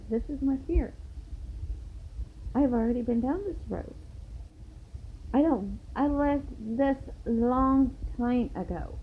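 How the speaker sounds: insistent, slowing down to drive the last point home; retold confrontation. Acted confrontation with the couple.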